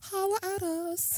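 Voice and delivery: slow, high-pitched sing-song invite; playful high call. Acted. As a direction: call out to her teasingly, stretched and high.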